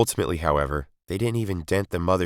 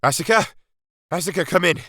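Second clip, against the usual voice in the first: high, louder call over the comms; raised radio call; 12 semitones higher, 4 dB louder than usual. A narrator's reading.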